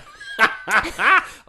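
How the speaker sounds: high, loud burst of laughter. Real, not acted. Host teasing a colleague who listens, loud laugh. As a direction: crack up at your own joke.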